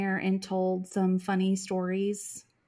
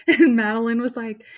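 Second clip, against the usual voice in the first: ordinary talk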